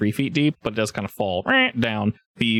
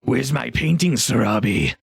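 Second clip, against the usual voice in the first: mocking ogre character voice; mock-demanding ogre greeting. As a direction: tease them as an ogre expecting disappointment. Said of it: "tone he gave you was very, like, mocking".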